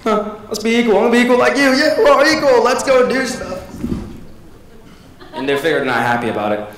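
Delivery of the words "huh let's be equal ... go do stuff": high, sing-song mock cheer; mock brainwashed pony. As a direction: imitate a brainwashed fan, bright and high. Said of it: "people are like".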